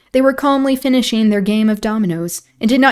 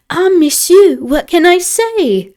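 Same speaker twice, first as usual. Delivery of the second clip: grateful elder lady; 10 semitones higher, 5 dB louder, slower than usual. A narrator's reading. Grateful elder French lady speaks.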